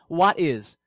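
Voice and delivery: normal talking voice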